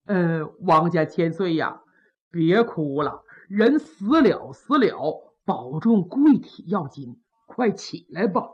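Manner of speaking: a lightly voiced old courtier coaxing; old official consoling. Old official urging the grieving prince to rise, low and gentle.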